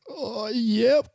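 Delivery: very high squeaky mock nerves; nervous yep. Nervous reply to cop.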